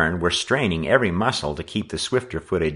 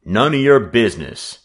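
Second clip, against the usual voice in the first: loud, curt brush-off; tartly forceful comeback; tart retort; 5 semitones higher, 7 dB louder than usual. A narrator's reading. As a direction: put the boy in his place. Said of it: "the newcomer retorted tartly".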